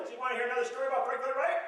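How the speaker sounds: fast, eager rush of words; eager old aunt. Retold or imitated. An old aunt eagerly offers visitors another story, fast and excited. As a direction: tumble out the offer to tell a story.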